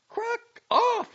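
high, loud furious retort; blazing anger. A narrator's reading. Girl correcting the doctor's hope, blazing with anger. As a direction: fling the words back, blazing. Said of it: "she blazed forth".

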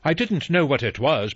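butler protesting "I didn't know what it was" in mild alarm; agitated cry. Acted. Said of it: "Rogers cried".

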